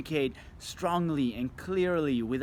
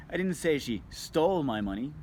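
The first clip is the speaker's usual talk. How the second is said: stole punched, the rest kept light; stress on STOLE. Shown as a demonstration. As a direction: lean on the word stole. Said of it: "seven different meaning ... word you emphasize".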